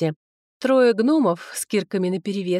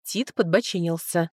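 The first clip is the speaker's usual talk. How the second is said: ordinary delivery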